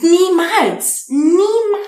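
high, loud, disbelieving niemals; amazed never. Retold or imitated. Recalling things she never expected, high and loud. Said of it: "wo ich denke".

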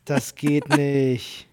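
very slow, low, drawn-out disbelief; slow mock disbelief. Real, not acted. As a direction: stretch it out in mock shock. Dragged-out mock shock.

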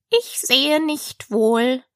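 slow, drawn-out contradiction; ponderous caterpillar voice; languid caterpillar. Acted.

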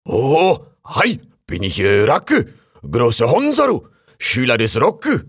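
raccoon introducing himself in a hammed-up Japanese accent, loud and high; mock Japanese accent. A narrator's reading. Raccoon introducing himself to the crane in mock Japanese accent. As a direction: announce yourself grandly in broken German.

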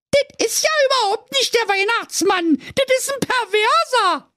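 put-on shrill high girl voice; outraged little girl; Brezel crying out. A narrator's reading. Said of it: "und sie rief".

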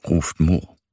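ordinary delivery

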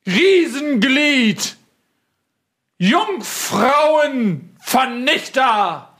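high, slow, pompous royal herald; herald announcement. Acted. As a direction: announce the king grandly and slowly. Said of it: "jemanden, der da steht neben mir".

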